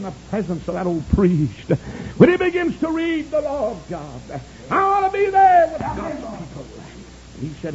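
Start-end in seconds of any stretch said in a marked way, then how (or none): 2.2-6.7 high, loud revival preacher; high-pitched pulpit cry; full-force preaching. Acted.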